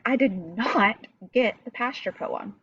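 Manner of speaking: "not" stands out emphatically from the rest; stress on NOT. Shown as a demonstration. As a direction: hit the word "not" hard. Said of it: "So he emphasized not".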